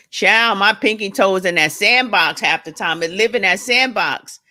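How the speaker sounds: loud, punchy sass; excited. Real, not acted. Hyping her joke to the live viewers, loud and sassy. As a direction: belt it out to the viewers.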